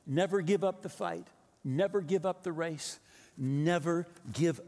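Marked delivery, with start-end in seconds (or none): none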